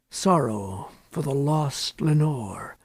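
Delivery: usual speaking voice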